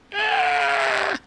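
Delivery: high yelping laugh outburst; shrieking laugh. Real, not acted. Streamer cracking up, high shriek. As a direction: burst into a yelp of laughter.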